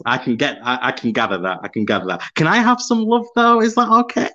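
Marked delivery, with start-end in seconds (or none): 2.3-4.3 high, laughing, begging for attention; playful pleading. Real, not acted. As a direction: ask for love with a cheeky laugh. Jokingly begging for love.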